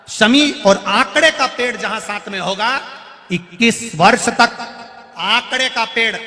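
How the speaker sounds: high, loud sermon at full force; fervent preaching. Acted. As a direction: proclaim it to the whole crowd. (ऊँची, ज़ोरदार प्रवचन की आवाज़)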